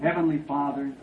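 soft, murmured prayer over the sick; hushed prayer. Real, not acted. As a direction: pray quietly over her, close and low.

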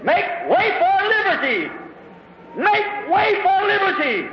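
high, ringing battle cry; a preacher's heroic war cry; screamed battle cry. Retold or imitated. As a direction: cry it out like a hero charging the spears. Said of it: "he raised up his hands and screamed".

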